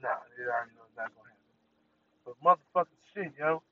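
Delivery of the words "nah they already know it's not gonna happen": fast aside. Real, not acted.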